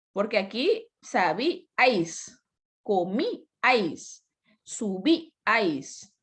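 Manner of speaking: "sabíais", "comíais", "subíais" drawn out slowly one by one; teacher modelling the words slowly; slow word demo. Shown as a demonstration. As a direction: read the example words out slowly. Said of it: "demonstrating triptongo vs hiato".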